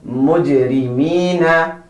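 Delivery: "Mujrimina" slow, long "mee" and light "na"; slow teacher's demo of "Mujrimina"; careful pronunciation model. Shown as a demonstration. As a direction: say "Mujrimina" drawn out with clear I vowels. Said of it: "Listen to me one more time".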